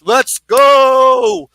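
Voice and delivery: high, loud, long-held "go"; excited rallying shout. Real, not acted. Host cheering on the Bucks, excited shout. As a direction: belt it out like a fan.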